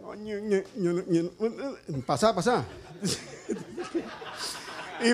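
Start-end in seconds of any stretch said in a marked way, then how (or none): none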